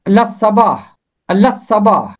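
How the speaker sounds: لصباح said lassaba, lam merged into the sad; correct pronunciation. Shown as a demonstration. Teacher gives the correct way to say لصباح. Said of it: "اس کا درست تلفظ ہے".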